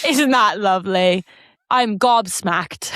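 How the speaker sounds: slow, put-on British voice; British accent. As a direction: ham up a prim Brit. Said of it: "say everything British we can think".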